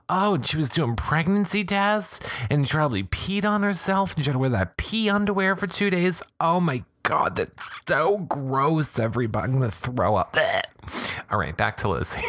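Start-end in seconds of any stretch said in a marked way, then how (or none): 0.1-10.4 high, grossed-out outrage; disgusted, nearly gagging. Real, not acted. Grossed out by pee underwear.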